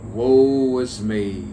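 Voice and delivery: loud, drawn-out poetic lament; hammed-up forlorn cry; mock forlorn. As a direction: stretch each word into a theatrical woe.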